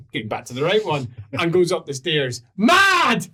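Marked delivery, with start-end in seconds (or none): 2.6-3.3 loud, high, long bellow; dwarf's bellow. Acted. Angry dwarf storming up the stairs, bellowing. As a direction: bellow it as she stomps upstairs.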